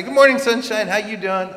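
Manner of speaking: high, loud, sing-song hello; bright cheery greeting. Retold or imitated. To the classmate entering class, a loud high cheery hello. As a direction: beam the greeting at her.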